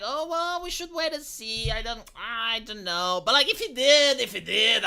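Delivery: high, drawn-out wavering; mock hesitation. Mimicking influencers reluctant to judge Mister Beast, mock hesitant.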